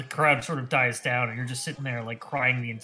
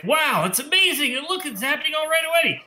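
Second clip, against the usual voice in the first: much higher, excited onlookers; amazed crowd; 11 semitones higher, 4 dB louder than usual. Acted. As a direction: gasp it out like a wowed crowd at a fair. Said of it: "people are like".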